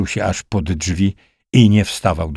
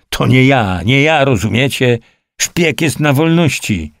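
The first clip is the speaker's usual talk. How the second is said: desperate protest; 6 semitones higher than usual. A narrator's reading. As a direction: insist on innocence, voice raised. Accused captain pleads his innocence. (rozpaczliwy protest podniesionym głosem)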